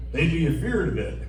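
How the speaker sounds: usual speaking voice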